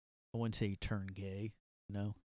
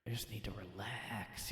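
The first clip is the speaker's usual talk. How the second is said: whisper; 3 semitones lower, 11 dB quieter than usual. Acted. Whispered under breath.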